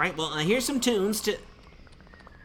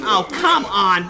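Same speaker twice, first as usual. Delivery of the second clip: shouting; 4 semitones higher, 5 dB louder than usual. Acted. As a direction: bark him out of the room. Throwing someone out.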